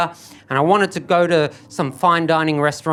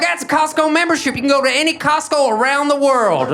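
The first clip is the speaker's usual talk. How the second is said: proud father-in-law; 8 semitones higher than usual. Retold or imitated. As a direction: sell it like the best gift ever. Father-in-law bragging about Costco.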